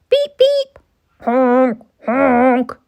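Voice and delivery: high loud beep and honk; horn noises. A narrator's reading. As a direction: honk it out like horns. Horns imitated in story.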